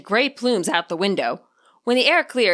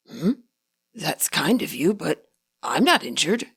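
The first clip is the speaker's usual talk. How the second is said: lightly voiced old man; 4 semitones lower than usual. A narrator's reading. Ingo voiced in story.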